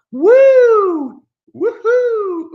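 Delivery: high, loud mock whoops; sarcastic woo. Mocking fans with a fake cheer, loud high woohoo. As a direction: fake a big excited cheer.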